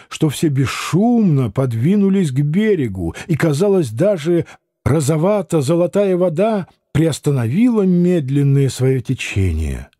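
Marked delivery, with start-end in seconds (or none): none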